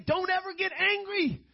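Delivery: high-pitched mock condemnation of sinners; mock scolding. Preacher parodies what Scripture doesn't say, high mock scolding. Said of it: "It doesn't say".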